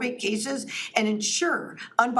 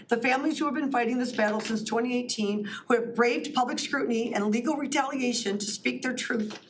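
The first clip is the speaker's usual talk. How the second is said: normal talking voice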